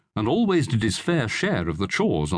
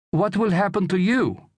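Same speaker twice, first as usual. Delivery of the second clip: high, anxious woman's voice; worried wife; 10 semitones higher, 3 dB louder than usual. A narrator's reading. Wife fearing for her husband in battle, worried. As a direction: press him with high-pitched concern.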